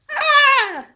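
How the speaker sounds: a sudden high, loud "Ah!" of triumph; triumphant cry. A narrator's reading. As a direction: burst out in triumph at the find.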